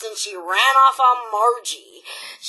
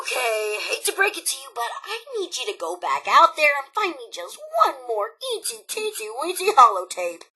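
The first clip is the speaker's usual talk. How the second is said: ordinary talk, nothing marked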